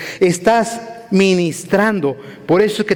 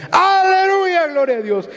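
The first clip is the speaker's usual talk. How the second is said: high, drawn-out hallelujah cry; acted-out church shout, leaping up in pitch; shouted praise. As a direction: cry out praise like an overjoyed worshipper. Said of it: "Si usted siente gritar".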